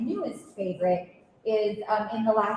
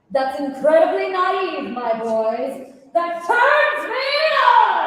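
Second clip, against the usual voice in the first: slow, rising to a much higher, louder finish; character line performed; 10 semitones higher, 7 dB louder, much slower than usual. Acted. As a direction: ham it up, ending in a gleeful squeal. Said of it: "my O G favorite line is the".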